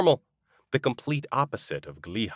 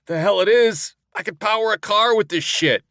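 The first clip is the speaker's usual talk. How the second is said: high, loud outraged disbelief; loud complaint; 11 semitones higher, 6 dB louder than usual. A narrator's reading. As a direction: protest loudly at the liquor. Complaining about strong moonshine.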